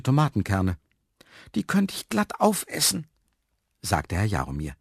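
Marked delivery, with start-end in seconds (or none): 1.5-3.1 much higher character voice; musing aloud in a high voice; high-voiced story character. A narrator's reading. Hungry man eyes the seeds, high-pitched musing.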